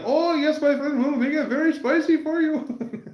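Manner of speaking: put-on high, sing-song Indian accent; Indian waiter voice. Restaurant waiter promising extra spicy food, high Indian accent. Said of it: "they'll be like".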